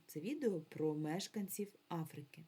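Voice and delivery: ordinary delivery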